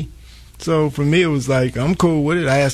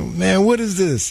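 higher, drawn-out puzzled question; retold bafflement; 7 semitones higher than usual. Recounting asking his son about Last Dance, baffled. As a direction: replay your own confusion, pitched high and slow.

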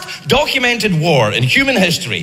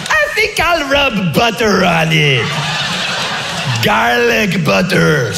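mock French Trojan taunting; 4 semitones higher, slower than usual. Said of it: "were like".

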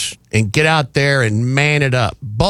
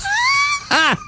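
high laugh. Real, not acted. Laughing at own quote.

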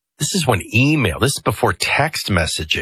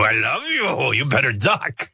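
Pepe Le Pew; 3 semitones higher than usual. Retold or imitated. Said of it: "looking like Pepe Le Pew and I go".

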